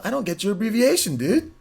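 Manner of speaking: ordinary delivery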